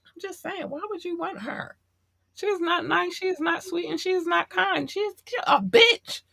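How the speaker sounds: mock her with a sweet falsetto; catty high-pitched sarcasm; high sarcastic voice. Mocking neighbor's qualities.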